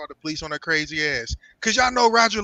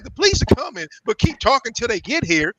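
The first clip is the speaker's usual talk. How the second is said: raised, higher acted voice; mock warning, pitched up and louder; acted calling out; 4 semitones higher, 6 dB louder than usual. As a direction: call through a locked door, playing it up. Acted exchange through a locked door.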